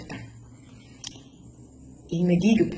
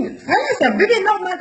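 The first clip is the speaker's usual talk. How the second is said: much higher, laughing disbelief; amazed relief; 12 semitones higher, 5 dB louder than usual. Retold or imitated. Mother seeing her newborn crying, amazed laughing relief. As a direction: marvel through a laugh that the baby is fine.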